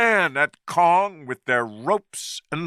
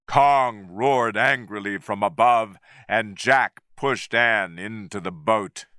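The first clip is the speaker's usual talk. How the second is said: ordinary delivery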